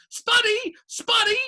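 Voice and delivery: very high, loud taunt; yelling. A narrator's reading. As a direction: yell the nickname after her, impishly.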